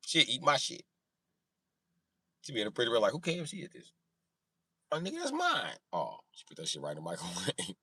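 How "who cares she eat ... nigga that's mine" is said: higher, dismissive mom; lightly voiced careless mother; mock mom. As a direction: brush the kid off.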